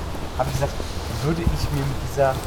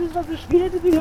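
jammernde Imitation; 14 semitones higher than usual. Äfft Falcos Beschweren nach.

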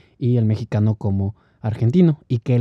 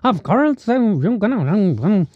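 high, twangy mock Texan sound; Texan accent; 9 semitones higher than usual. Imitating the typical Texan accent, mock Texan twang. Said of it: "acento tejano".

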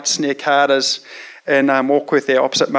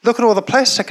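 lightly voiced excited little boy, pitched up; four-year-old son; 8 semitones higher than usual. Son pointing out plastic at the skate park, childlike excited voice. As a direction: pipe up like an eager kid spotting something. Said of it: "And he goes".

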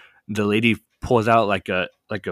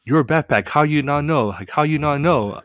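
higher, louder retold guard voice; imitating guards; 5 semitones higher, 4 dB louder than usual. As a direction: mimic the guards pressing him, a touch louder. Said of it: "the guys keep saying".

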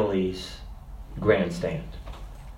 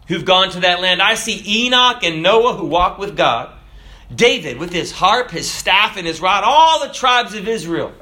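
fervent preaching; 4 semitones higher than usual. Acted. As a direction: preach it higher, with rising fervor. Preaching the hymn's vision.

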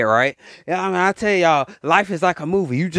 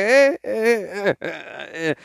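imitated girlish giggle; slower than usual. Imitating girls giggling at a nice looking dude. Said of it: "they start giggling and stuff".

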